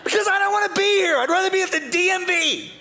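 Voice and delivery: put-on father griping to his kids in the heat; grumpy dad outburst. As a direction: snap back like a miserable dad at Disney.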